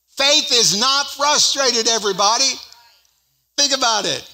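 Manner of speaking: high, loud preacher's declaration; emphatic preaching. Real, not acted. Preacher challenging the congregation about faith, emphatic preaching. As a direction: push it out high and hard to the crowd. Preacher driving home his point.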